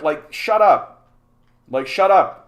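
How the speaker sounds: fed-up and loud; angry retort. Real, not acted. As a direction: shut them down forcefully.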